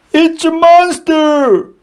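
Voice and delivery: high, frightened cry; scream for help. A narrator's reading. Dragon jumping in the air, screaming in fear. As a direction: shriek it in panic. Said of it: "he screamed".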